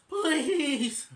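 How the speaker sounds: put-on high, loud begging kid voice; Alvin's pleading chipmunk voice. Alvin begging Dave to take him on the trip, high and loud. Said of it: "And Alvin says".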